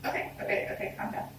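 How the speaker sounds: soft whispered calming; soothing dad. Retold or imitated. Dad calms his crying daughter, soft and soothing. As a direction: gently settle her down.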